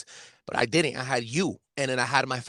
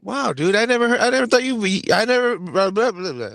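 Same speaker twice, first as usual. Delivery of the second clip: stunned, fumbling for words, rising pitch; dumbfounded; 6 semitones higher, 6 dB louder than usual. Real, not acted. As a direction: blurt it out in disbelief. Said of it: "I'm lost for words".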